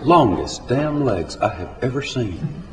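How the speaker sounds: folksy storytelling verse with deliberate pauses; comic story poem recitation. Acted.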